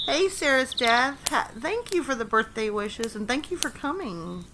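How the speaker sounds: ordinary delivery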